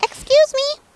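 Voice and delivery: very high tiny voice; little voice. Acted. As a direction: call out like a tiny bird. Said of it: "a little voice called out".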